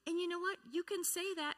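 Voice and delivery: normal talking voice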